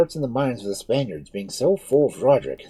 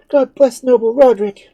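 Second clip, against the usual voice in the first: louder and higher, a fervent hail; joyful loud blessing; 15 dB louder than usual. Acted. Townswoman hailing Roderick to Pauline, fervent loud blessing. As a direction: bless the hero out loud with joy.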